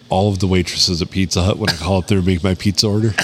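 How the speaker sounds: ordinary talk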